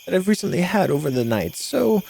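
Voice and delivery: put-on high, thin old lady voice; old woman voice. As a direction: croon it like a sweet old granny. Innkeeper figure keeps voice.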